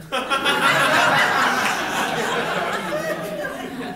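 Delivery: a short, pleased laugh; amused laugh. Acted. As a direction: laugh along at her own quote. Boss laughs at her quote.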